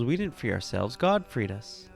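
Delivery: usual speaking voice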